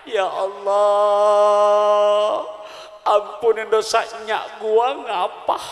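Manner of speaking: slow, drawn-out tearful prayer in Betawi dialect; mock weeping prayer. Grandmother showing her prayer for her late mother, slow mock-tearful wail. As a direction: stretch every word like an old woman praying through tears. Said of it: "Dicontohin, Pak, sambil nangis".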